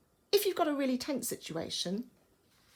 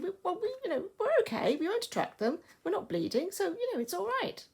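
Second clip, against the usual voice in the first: high-pitched, practical reasoning; practical, rational reassurance; 9 semitones higher, faster than usual. Acted. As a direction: reason it out in a higher voice, reassuring yourself. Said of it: "she's very practical".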